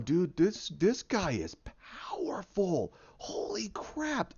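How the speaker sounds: stunned, drawn-out whisper; awed whisper. Acted. Onlookers watch Hulk's spectacle, awed whisper. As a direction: whisper it slowly in disbelief.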